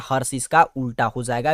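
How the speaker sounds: ordinary delivery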